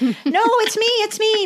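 high, desperate little voice; pleading little dog. Acted. Dog begging his family to know him, high and pleading. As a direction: plead to be recognized. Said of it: "he keeps trying to tell them".

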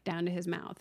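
usual speaking voice

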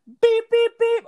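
high, loud beeps; imitated alarm beeps. Teller showing his RPMs going all the way up, high alarm beeps. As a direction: beep like a warning alarm. Imitating revs climbing to the top.

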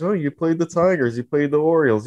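snooty mocking sneer. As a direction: sneer like a dismissive outsider. Mocking outsiders' dismissal.

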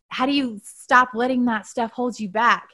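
normal talking voice

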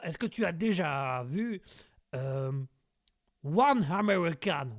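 comic American character speaking French; American accent. Acted. American character challenges the host's accent, exaggerated American accent. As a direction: question incredulously in a put-on American accent. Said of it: "les accents de Guégué".

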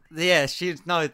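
usual speaking voice

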